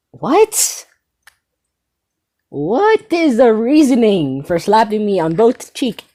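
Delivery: high, slow, exaggerated disbelief; mock outrage. To viewers about his girlfriend's slaps, high slow mock disbelief. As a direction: whine it out high and slow as if wronged. Indignant complaint about being slapped.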